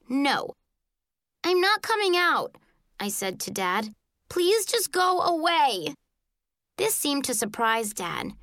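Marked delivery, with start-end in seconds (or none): 1.4-2.5 high, defiant refusal. A narrator's reading. Child in closet refusing Dad, high defiant call. 4.3-5.9 high, slow exasperated plea. A narrator's reading. Child telling Dad to leave, high exasperated plea.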